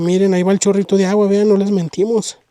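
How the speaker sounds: excited. Real, not acted. Shows the spring to viewers.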